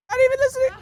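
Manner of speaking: rushed, high, soft whine; a fed-up student muttering fast; quick mock complaint. Teacher playing a student, fast high whine. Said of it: "You asked the question, bro".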